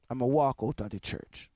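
normal talking voice

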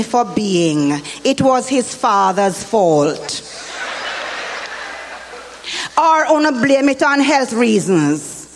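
scolding Jamaican woman, lively; Jamaican auntie voice. Acted. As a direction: lecture parents as a Jamaican auntie. Jamaican character monologue.

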